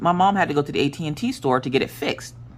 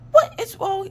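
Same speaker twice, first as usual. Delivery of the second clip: very high, baffled motherly question; incredulous, pitched-up mom voice; retold bewildered mom; slower than usual.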